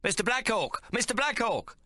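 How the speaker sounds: high calling voice for the chef. Acted. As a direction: call across the tent. Chef calling across tent.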